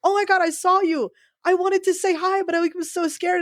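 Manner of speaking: high-pitched gushing retelling; imitated excited fan. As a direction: gush like a star-struck fan being quoted. Retells an excited fan's message.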